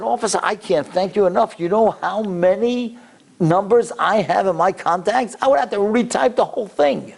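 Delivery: high, gushing thanks; bubbling over with gratitude; excited. Retold or imitated. Effusive thanks, pitch climbing.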